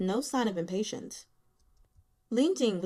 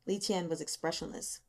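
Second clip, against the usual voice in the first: usual speaking voice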